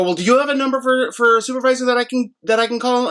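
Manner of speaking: high, pressing request for a supervisor; exasperated ask for a supervisor; pointed demand. Retold or imitated. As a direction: press him for the supervisor's number.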